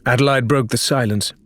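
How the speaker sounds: usual speaking voice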